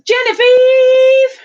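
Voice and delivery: loud, high, drawn-out holler; hammed-up high greeting call; calling out a name. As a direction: sing the name out high and long.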